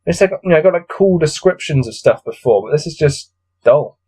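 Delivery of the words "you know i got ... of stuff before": normal talking voice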